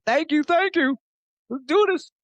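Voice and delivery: very high, loud, gleeful squeal; pep up tiny atoms in a high put-on voice; squeaky cheering voice. Man thanking living atoms, squeaky cheering.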